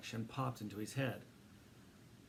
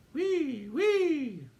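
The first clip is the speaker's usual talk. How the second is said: high, loud ambulance siren; siren wail; 9 dB louder than usual. A narrator's reading. Mouse sounding siren.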